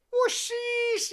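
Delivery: very high, loud disbelieving shriek; shrill outraged squeal. Retold or imitated. Drummer showing the manager the room, high outraged shriek. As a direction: squeal it in disgust. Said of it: "He goes".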